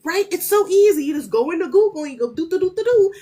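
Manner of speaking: ordinary delivery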